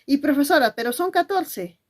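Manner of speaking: objecting pupil. Acted. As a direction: protest to the teacher as a pupil would.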